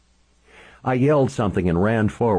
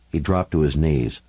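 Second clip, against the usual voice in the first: usual speaking voice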